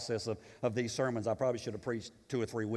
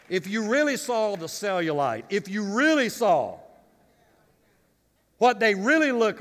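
higher and louder preaching, pressing hard; 6 semitones higher, 8 dB louder than usual. Real, not acted. Pastor warning younger ladies about airbrushed movie stars, fervent preaching. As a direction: drive each point home.

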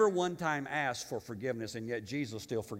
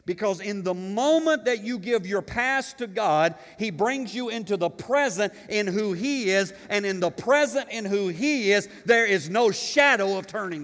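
full-force preaching, louder and higher; 7 semitones higher, 9 dB louder than usual. Real, not acted. Pastor carried away before his congregation, preaching at full force. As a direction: preach it out to the whole church. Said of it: "I'm preaching".

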